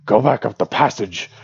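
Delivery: loud, odd goblin voice; goblin shout. Acted. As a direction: bark it like a goblin guard.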